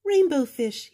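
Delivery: high, loud little-fish call; little blue fish calling. Acted. Little blue fish calling after Rainbow Fish, high and loud. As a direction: call out brightly to the big fish. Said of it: "he called".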